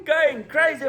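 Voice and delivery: normal talking voice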